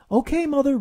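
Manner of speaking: put-on high, loud grudging child; reluctant Roo. A narrator's reading. Roo obeys his mother, reluctant child. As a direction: give in grudgingly. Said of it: "Roo said reluctantly".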